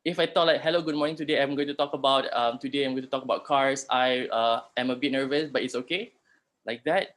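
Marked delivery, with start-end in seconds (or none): 0.6-6.2 rushed, fast nervous presenter; nervous rushed speech. Shown as a demonstration. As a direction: gabble it like a jittery speaker. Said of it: "that shows that you are nervous".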